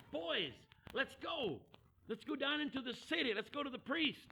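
high-pitched eager urging; rally the group to get moving; excited. Retold or imitated. Samaritan urging the other lepers to the priest, excited. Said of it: "turns around excited and says".

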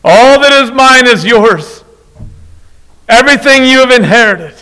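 high, loud, earnest plea; father pleading. Acted. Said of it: "The father pleaded".

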